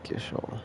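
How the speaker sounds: soft GISH-ul for Gysahl; trying out pronunciation. Shown as a demonstration. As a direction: test Gysahl under the voice, with SH. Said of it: "I'm pretty sure I pronounced it".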